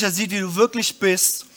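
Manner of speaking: ordinary delivery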